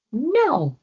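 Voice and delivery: high loud shout of no. Acted. Floki shouts at the tricksters, high loud no. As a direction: shout them all away. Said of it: "he shouted at them".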